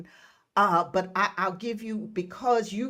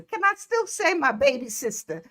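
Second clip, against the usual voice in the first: normal talking voice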